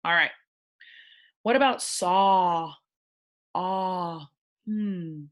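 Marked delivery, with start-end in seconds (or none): 1.4-4.3 slow "saw" with a drawn AW; teacher stretching the vowel; vowel sound demo. Shown as a demonstration. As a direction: pull out the AW in "saw". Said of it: "Sounds like awe".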